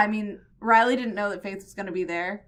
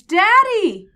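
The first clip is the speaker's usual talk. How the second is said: high, loud acted scream; shrieked imitation of a daughter's scream; retold scream; 12 semitones higher, 12 dB louder than usual. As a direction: cry out like a shocked daughter. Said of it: "she just screams".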